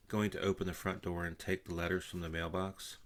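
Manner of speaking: ordinary delivery, nothing marked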